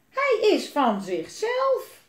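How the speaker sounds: put-on loud, high, drawn-out child's protest; calling out. A narrator's reading. Elise insists the dragon is its own, calling out. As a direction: stretch each word in high protest. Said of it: "riep Elise".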